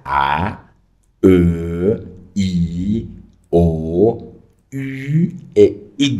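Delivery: A, E, I, O, U, Y recited as French vowels; teacher's drawn-out "A, E, I, O, U e Y". Shown as a demonstration. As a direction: sound out each vowel loudly, held and separate. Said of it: "en francés existen seis vocales".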